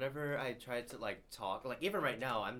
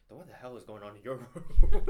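laughing retort; 20 dB louder than usual. Retold or imitated. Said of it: "I'm just like".

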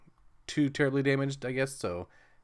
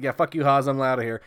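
louder, higher mock taunt; half-joking louder fleeing taunt; mock brush-off; 3 semitones higher, 8 dB louder than usual. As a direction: yell it as you bail. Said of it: "he's like".

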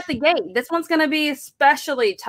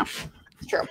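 whispering; 12 dB quieter than usual. Real, not acted. Aside after cat joke.

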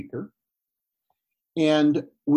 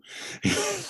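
breathy laugh; 4 dB quieter than usual. Real, not acted. Laughs at the phone mix-up.

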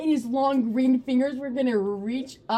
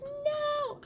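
one high, squealed refusal; panicked refusal. Retold or imitated. Little girl refuses the movie, high-pitched mock panic. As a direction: play a scared kid digging in.

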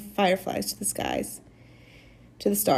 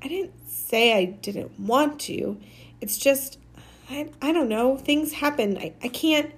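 halting, higher-pitched protest; struggling for words; 5 semitones higher than usual. A narrator's reading. Maniac explains to Mars Bar why he can't visit, struggling for words. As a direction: stumble and restart, groping for an explanation. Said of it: "Maniac struggled for words".

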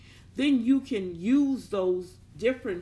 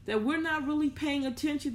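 usual speaking voice

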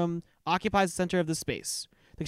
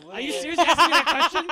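incredulous, laughing through it; laughing disbelief; 8 semitones higher, 9 dB louder, faster than usual. Real, not acted. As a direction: laugh while asking in disbelief. GM laughing at absurd question.

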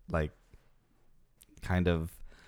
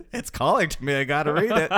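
laughing impression; 6 semitones higher, 5 dB louder than usual. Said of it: "she's like".

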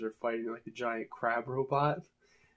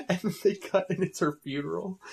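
normal talking voice

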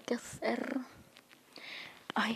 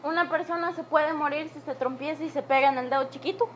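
loud; 5 semitones higher, 5 dB louder than usual. Real, not acted. Asking mom, still in pain.

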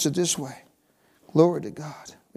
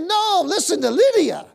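excited shout; 10 semitones higher, 5 dB louder than usual. Retold or imitated. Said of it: "I started to shout".

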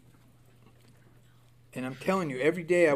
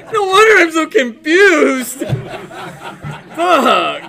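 laughing; 11 semitones higher, 13 dB louder, slower than usual. Real, not acted. Laughing at own mixed funeral.

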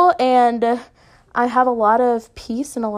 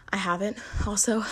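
normal talking voice

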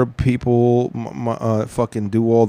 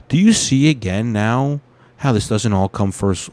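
usual speaking voice

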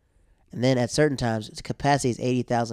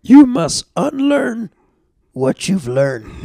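much higher, much louder, slow Yoda voice; Yoda impression; 7 semitones higher, 10 dB louder, much slower than usual. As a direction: do Yoda, stretching every word. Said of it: "That's freaking a Yoda quote".

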